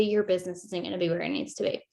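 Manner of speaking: ordinary talk, nothing marked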